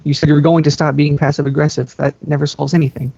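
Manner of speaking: ordinary delivery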